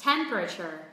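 TEM-pruh-cher for Temperature, falling after TEM; first-syllable stress. Shown as a demonstration. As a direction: hit TEM in Temperature and reduce the rest. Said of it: "Trọng âm rơi âm tiết thứ nhất".

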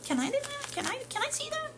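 put-on high, fast, soft little kid voice; mock kids pleading, high and hurried; eager kids asking. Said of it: "they're like".

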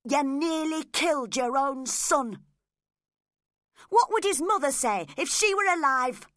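crying out in reproach. Acted. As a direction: scold him for nearly killing his son. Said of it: "I cried".

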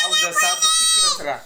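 high, loud, tearful curse; angry outburst. Real, not acted. Father curses the wife who left, in a tearful shout. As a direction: hurl it at someone gone.

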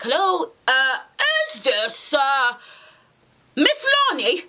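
imitated posh caller, hesitant, rising high on the name. Police caller checking her name, mock-posh and halting. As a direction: put on a posh phone voice. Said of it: "that kind of voice, like a posh, like, voice".

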